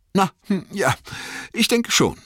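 high, hesitant hobbit voice; stammelnd. A narrator's reading. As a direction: stammer it out haltingly in Bilbo's high voice. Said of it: "stammelte Bilbo". (zögerlich gestammelt, hohe Stimme)

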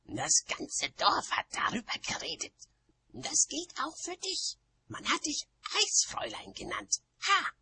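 high, scornful witch voice; high-pitched sneer; jeering. A narrator's reading. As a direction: taunt in a high, partly whispery voice. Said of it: "höhnte Nanny".

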